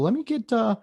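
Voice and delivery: high, mocking customer ordering; mocking bar customer. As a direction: mimic someone ordering a Jack and Coke. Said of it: "you see people".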